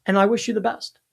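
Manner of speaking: ordinary talk, nothing marked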